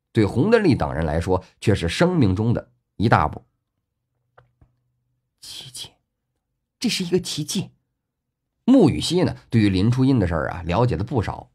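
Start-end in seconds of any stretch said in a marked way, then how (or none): 5.4-7.7 hushed, breath-only first word, then soft and high; soft, amazed undertone; awed whisper. A narrator's reading. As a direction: murmur it in wonder. Awed onlooker voiced after the miracle.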